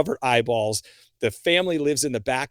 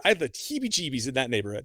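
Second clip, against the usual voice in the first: ordinary talk, nothing marked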